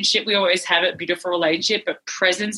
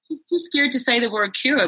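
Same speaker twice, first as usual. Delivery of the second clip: normal talking voice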